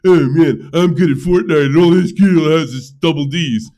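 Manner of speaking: loud whiny gamer imitation; mock whining gamer. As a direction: whine like a sore loser. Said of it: "the guys whine and cry".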